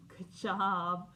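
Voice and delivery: plain reading voice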